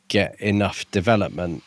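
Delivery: ordinary talk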